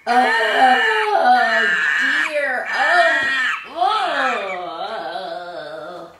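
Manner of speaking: very loud crying monkey howl; monkey wail. Acted. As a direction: howl it back like a wailing monkey. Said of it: "and said".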